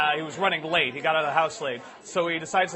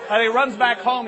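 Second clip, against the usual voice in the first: ordinary talk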